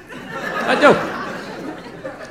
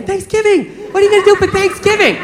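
put-on higher, gushing girlfriend voice; excited girlfriend; 7 semitones higher than usual. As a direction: gush about the holiday like an eager girlfriend. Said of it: "She's like".

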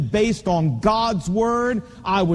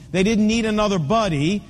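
ordinary delivery

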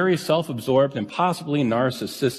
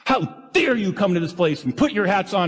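retold scolding; 6 semitones higher than usual. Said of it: "she just chewed 'em out good".

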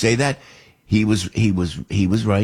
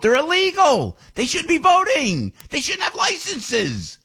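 outraged rant. Real, not acted. Ranting against illegal voting.

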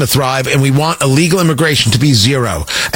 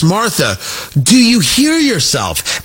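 imitated incredulous, pitched-up challenge; J D Vance; 4 semitones higher, slower than usual. Host imitating candidate challenging interviewer, voice going up. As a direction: voice rising high on a slow question. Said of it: "And his voice went up".

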